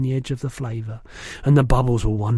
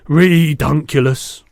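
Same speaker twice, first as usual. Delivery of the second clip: slow, louder scoff; scoffing giant; 7 dB louder, slower than usual. A narrator's reading. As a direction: drag it out in disbelief. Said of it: "said the B F G".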